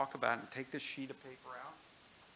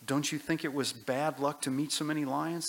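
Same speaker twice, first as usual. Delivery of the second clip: put-on louder, higher young boy protesting; a boy complaining about his bad luck; boy Shasta, upset; 3 semitones higher, 10 dB louder than usual. Shasta speaks to the voice.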